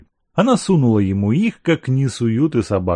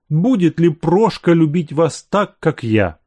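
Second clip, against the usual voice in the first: a lightly voiced old servant, pleading and higher; lovesick servant; 6 semitones higher, 3 dB louder than usual. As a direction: coax her like a heartsick servant. Servant pleads his love.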